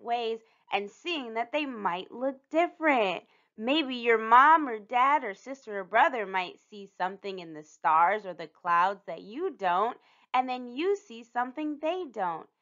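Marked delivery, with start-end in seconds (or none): none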